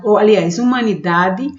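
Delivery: normal talking voice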